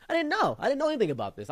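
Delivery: very high, loud surprise; mock shock. The narrator recalls his shock at the lore, very high and loud. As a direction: squeal it in disbelief.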